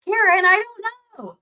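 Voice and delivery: high, drawn-out slow whine; expressive imitation. Streamer mocks how she'd read the text, high and slow. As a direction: ham up the reply with big inflection. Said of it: "the way I would be like".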